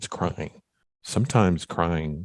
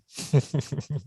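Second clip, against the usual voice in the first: high, short burst of laughter; amused laugh; 10 semitones higher than usual. Real, not acted. Host laughs after thanking guest back, high amused laugh. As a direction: laugh in a high, light run.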